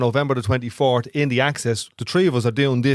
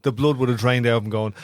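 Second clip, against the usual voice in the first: ordinary delivery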